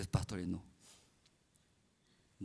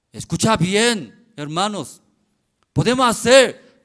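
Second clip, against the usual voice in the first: pastor insisting; 8 semitones higher, 20 dB louder than usual. Retold or imitated.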